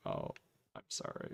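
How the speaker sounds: whispered, barely audible sorry; sheepish apology. Retold or imitated. Caught friend apologizing in a faint guilty whisper. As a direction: breathe it out, ashamed and tiny.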